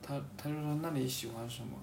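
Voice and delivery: ordinary talk, nothing marked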